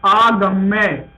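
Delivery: drawn out. Shown as a demonstration. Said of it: "य आ जाएगा".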